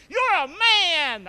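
high, loud and slow; loud slow proclamation. Real, not acted. Pastor leads the men, shouting slowly. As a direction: drag it out as a big declaration. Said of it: "Say it with me".

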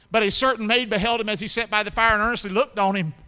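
loud, high revival preaching; preacher building to a shout; full-force preaching. Acted. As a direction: drive the story home to the congregation. Preaches the Peter story.

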